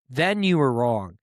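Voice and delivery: normal talking voice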